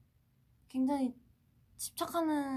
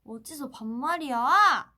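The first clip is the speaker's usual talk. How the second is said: loud, drawn-out playful yell; mock scolding shout; 2 semitones higher, 12 dB louder, slower than usual. Idol scolding fans for casual speech, mock shout. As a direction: let the last word swell into a mock-outraged shout. Louder retake of requested catchphrase.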